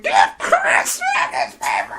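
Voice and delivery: very high mock shriek; screaming little girl. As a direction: screech it like a child who won't stop. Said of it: "still screaming".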